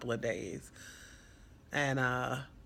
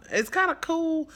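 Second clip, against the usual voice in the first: much higher, louder, gushing; excited repeat; 6 dB louder than usual. Shown as a demonstration. Foster mom, pleased her runaway wanted back, gushing higher. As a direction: pitch it up and beam the words kinda cool.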